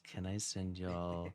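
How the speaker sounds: slow, soft seductive voice; sultry voice. Pretend. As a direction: purr it slowly and softly. Acting out a spicy audio.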